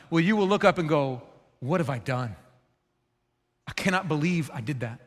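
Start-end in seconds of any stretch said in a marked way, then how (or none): 1.6-5.0 quiet, dismayed self-question; regretful murmur. Retold or imitated. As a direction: murmur it as if to yourself, stunned. Preacher voices regretful self-talk.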